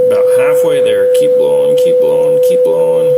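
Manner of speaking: firm, loud encouragement to keep going; loud coaching. Real, not acted. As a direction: push them to blow longer, loud. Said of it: "coaching a breath test".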